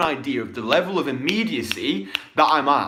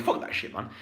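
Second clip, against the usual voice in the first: whispered dismissal; 4 semitones lower, 7 dB quieter than usual. Real, not acted. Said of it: "whispered dismissal".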